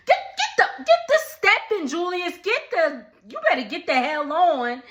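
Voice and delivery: shooing him out with attitude; sassy dismissal. Real, not acted. Telling Julius to leave.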